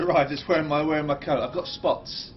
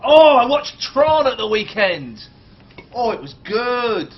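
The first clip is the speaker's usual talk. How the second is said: louder, higher and slow; excited; 7 semitones higher, 11 dB louder, slower than usual. Real, not acted. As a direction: gush about it loudly. Excited about Tron.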